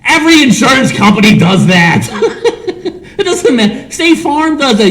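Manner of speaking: high, laughing mock rant at an ad; laughing high mock tirade; mock yelling at TV. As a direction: squeal it out through laughter. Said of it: "And I yell out".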